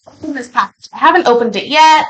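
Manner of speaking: usual speaking voice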